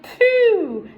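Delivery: high, loud cry of disgust at the stink; disgusted pooh. Acted. Len digging in the muck, loud and high with disgust. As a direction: recoil from the smell, loud and high. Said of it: "Len said".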